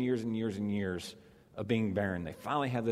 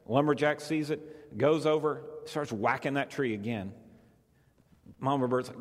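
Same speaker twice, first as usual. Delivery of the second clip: ordinary talk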